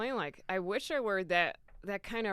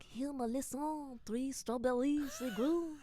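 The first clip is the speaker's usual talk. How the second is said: put-on slow, higher-pitched French girlfriend voice; French accent; 6 semitones higher, slower than usual. Guitar teacher's girlfriend offering garden strawberries, slow French accent. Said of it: "how to do the accents".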